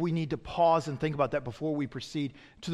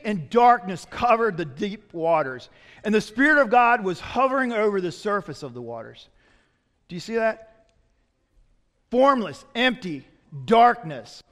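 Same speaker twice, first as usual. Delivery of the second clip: normal talking voice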